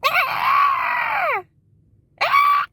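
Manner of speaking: very high imitated toddler shriek; piercing mock child squeal; kid screeching. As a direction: shriek like a noisy little kid. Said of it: "her kid going".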